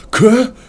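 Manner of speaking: sudden high choking splutter; gagging cough. Acted. Prince chokes in front of royals, high sputter. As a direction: choke out a sound like spitting up.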